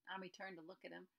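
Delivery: usual speaking voice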